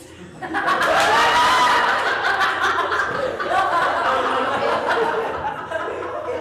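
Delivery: long, loud peal of laughter; real laughing fit. Real, not acted. Player losing it after saying "migrant", laughing loudly. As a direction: laugh helplessly, high and loud. Cracks up at own wrong guess.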